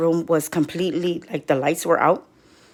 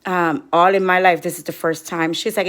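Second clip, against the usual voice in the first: normal talking voice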